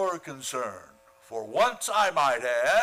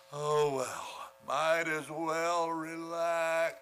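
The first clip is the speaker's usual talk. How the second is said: soft, slow lulling voice; quiet and slow, like a lullaby; soothing; 4 semitones lower, 8 dB quieter, slower than usual. Acted. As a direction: lull him back to sleep. Soothing Conklin back to sleep.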